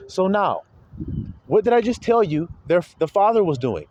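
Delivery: ordinary talk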